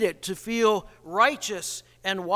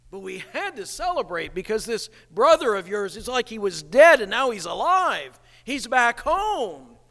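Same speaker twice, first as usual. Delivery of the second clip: eager father; 3 semitones higher, 5 dB louder than usual. Retold or imitated. Father pleading with older son.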